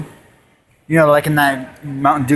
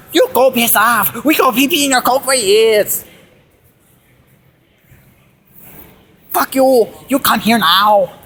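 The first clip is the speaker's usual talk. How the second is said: mock Chinese accent; 5 semitones higher than usual. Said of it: "So the Chinese guy says".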